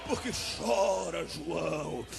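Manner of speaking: very low, drawn-out calling voice; a mighty voice calling down from heaven; booming Lamb. Acted. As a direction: call John from deep down. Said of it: "o Cordeiro lá brada".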